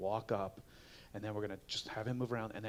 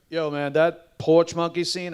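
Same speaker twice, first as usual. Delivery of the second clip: imitating a fan; 4 semitones higher, 9 dB louder, slower than usual.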